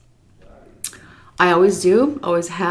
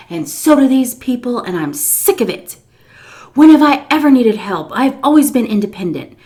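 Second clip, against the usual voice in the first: angry ranting; 6 semitones higher, 6 dB louder than usual. Retold or imitated. Miss C in her daughter-in-law's face, angry rant. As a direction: go on and on, indignant. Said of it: "She was just going on and on".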